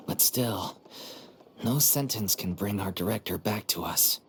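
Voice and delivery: normal talking voice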